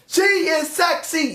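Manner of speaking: high, slow, drawn-out mock enthusiasm; hammed-up like a swooning fan; mocking crowd cheer. Mimicking crowd's excitement.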